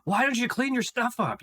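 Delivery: high-pitched mock yell at the mess; playing back his own yelling at the mess; imitated yell. Husband mimicking how he finally yells at his wife, mock yell.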